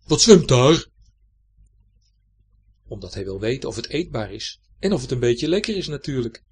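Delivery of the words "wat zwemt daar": put-on loud booming call of a half-blind old fish; old pike calling. A narrator's reading. Old pike shouting at something swimming past, loud call. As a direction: bellow it out into the murky water. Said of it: "dan roept hij".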